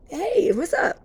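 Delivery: high, airy hello from the ether; chirpy spirit greeting. Retold or imitated. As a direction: greet like a bright little spirit. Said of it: "in the ether".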